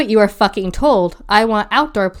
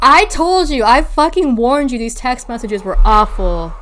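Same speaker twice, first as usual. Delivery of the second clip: louder and higher, fed-up; exasperated outburst; 4 semitones higher, 5 dB louder than usual. Real, not acted. To her co-host about the texts, an exasperated outburst. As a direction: burst out, I warned you.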